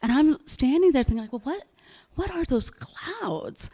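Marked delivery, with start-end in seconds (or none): 1.3-3.7 high, slow, wondering aloud; puzzled disbelief. Retold or imitated. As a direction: stretch it out, baffled. Retold puzzled thought.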